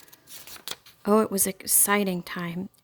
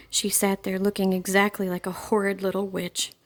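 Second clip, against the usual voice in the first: ordinary delivery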